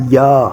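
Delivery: "یا" as a long open "yaa"; second vowel sound. Shown as a demonstration. Teacher showing learners the second Korean vowel, drawn-out "یا". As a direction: draw out "یا" with an open "aa". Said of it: "وہ آواز نکالتی ہیں یا".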